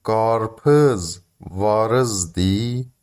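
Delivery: گارپز وارز دی said slowly in Turkish, asking; Turkish phrase. Shown as a demonstration. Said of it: "یعنی هندونه دارین؟". (جمله ترکی آهسته و پرسشی)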